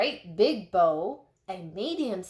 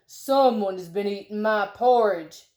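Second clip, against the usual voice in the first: big bear voice, louder, bellowed; Papa Bear bellowing; 6 dB louder than usual. A narrator's reading. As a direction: boom it out like a huge papa bear. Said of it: "bellowed in his great big voice".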